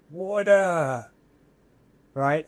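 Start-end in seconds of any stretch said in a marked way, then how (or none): 0.1-1.1 high, loud cry for water; injured driver calling out from the wreck; crash victim's cry. Retold or imitated. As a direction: call out like the man trapped in the car. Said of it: "the person says".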